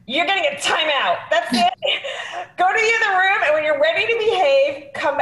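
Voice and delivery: put-on scolding mom sending a kid to timeout; stern mother. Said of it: "they needed a mother going".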